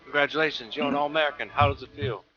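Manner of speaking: a touch of an eager sports reporter; mock interviewer. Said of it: "he's like".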